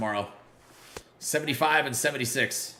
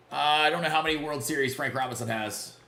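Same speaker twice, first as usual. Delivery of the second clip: normal talking voice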